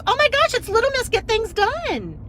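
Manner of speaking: high, loud delighted squeal of recognition; the counter woman recognizing her, high excited gush; excited fan greeting. Retold or imitated. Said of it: "the woman behind the counter goes".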